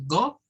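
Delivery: "go" short with a pure o; "go" as read in Spanish. Shown as a demonstration. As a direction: say "go" without any glide. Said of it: "se está quedando en el sonido del español".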